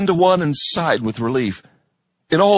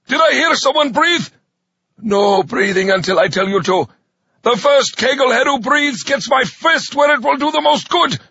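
menacing drill sergeant; 12 semitones higher, 4 dB louder than usual. A narrator's reading. Sergeant threatens recruits.

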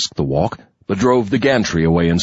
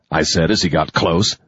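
usual speaking voice

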